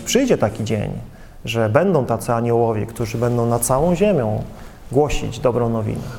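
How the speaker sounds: ordinary talk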